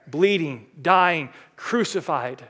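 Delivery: ordinary delivery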